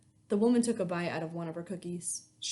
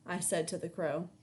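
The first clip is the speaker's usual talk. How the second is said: ordinary delivery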